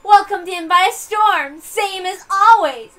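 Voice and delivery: high, loud and slow, savouring each word; gloating girl. Acted. Washed-up girl mocking the island, slow loud gloat. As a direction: taunt the storm like it owes you a show. Drifter girl taunting the storm.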